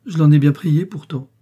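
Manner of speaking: high, loud plea of innocence; tearful protest. A narrator's reading. Young maid defending herself to her mistress, tearful protest. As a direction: wail it lightly, upset. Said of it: "s'écria l'innocente qui fondit en larmes".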